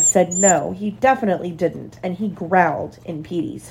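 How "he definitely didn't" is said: ordinary delivery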